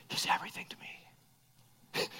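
faint whisper of a dying woman; deathbed whisper. Retold or imitated. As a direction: barely breathe the words out.

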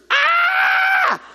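loud, very high-pitched scream; imitated shriek of terror; scream of fear. Preacher imitating wife's cry for fear, piercing scream. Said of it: "how do you cry out for fear?".

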